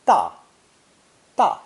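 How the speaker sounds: da with a sharp falling tone; fourth tone. Shown as a demonstration. Teacher models da for the Chinese learner, sharp falling tone. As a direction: drop the pitch sharply on da. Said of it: "sharp and falling tone".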